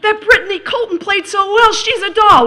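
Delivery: hammed-up giddy schoolgirl voice; gushing teen. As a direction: read it like a bubbly teenage fan. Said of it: "she'd write something like".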